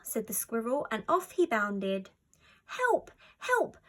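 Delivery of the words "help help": much higher, louder panicked cry for help; frantic cry. A narrator's reading. Little fox crying out as leaves are stolen, frantic. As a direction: yell for help in a frenzy. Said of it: "cried a frantic Ferdy".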